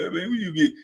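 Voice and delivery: softly imitated buddy; imitating slow-talking friend. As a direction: lightly mimic his voice, kept soft. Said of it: "He got a real deep, slow voice".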